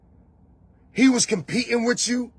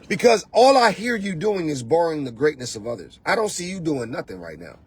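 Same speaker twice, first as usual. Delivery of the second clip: ordinary talk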